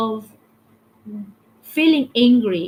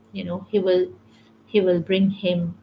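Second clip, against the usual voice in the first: ordinary talk, nothing marked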